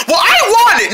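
high-pitched grabby insistence; high, stubborn claim; demanding. Acted. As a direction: insist on it like a squabbling kid. Quarrel over the quarter.

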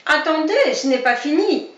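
angry fox calling into the burrow; growling fox. Acted. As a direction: snarl it after the fleeing rabbits. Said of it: "gronda le renard".

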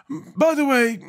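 very high, put-on character voice; Scarecrow. A narrator's reading. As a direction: ask it in a strange high voice. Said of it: "the Scarecrow asked".

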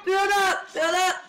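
high, loud repeated order; urgent command. Real, not acted. Player orders friend to build while fleeing, urgent. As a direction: call it out urgently.

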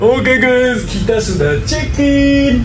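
high, slow thrilled announcement; excited. Real, not acted. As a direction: burst in, delighted. Arrived in room, thrilled.

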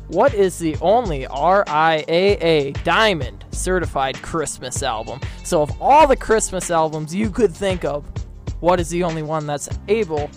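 ordinary talk, nothing marked

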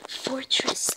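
whispered under the breath; whispering. Real, not acted. Reading place name in whisper.

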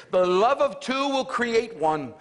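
high, slow, stretched-out sermon line; preacherly proclamation. Retold or imitated. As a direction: proclaim it like a deacon teaching his son. Retold deacon father's lofty teaching.

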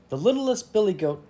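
high little goat voice; squealing baby goat. A narrator's reading. Baby goat introduces himself to troll, squealing. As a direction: pipe up small and high. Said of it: "Baby Gruff squealed".

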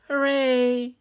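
high, loud, long hooray; drawn-out happy cheer. A narrator's reading. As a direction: cheer with delight. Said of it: "Iguana clapped".

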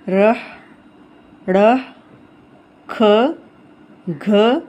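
each aspirated letter said slowly and clearly; reading out aspirated sounds. Shown as a demonstration. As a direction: sound out every breathy consonant. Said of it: "सारी पढ़ लेंगे". (हर भारी आवाज़ साफ़-साफ़ अलग करके बोलना)

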